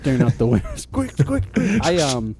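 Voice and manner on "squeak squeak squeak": high laughing squeaks; squeaky toy imitation. Mimicking the dog's chew toy, high squeaks.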